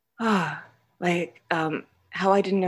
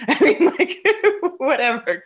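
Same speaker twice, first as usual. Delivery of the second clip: laughing; 10 semitones higher, 7 dB louder than usual. Real, not acted. Laughing at own past.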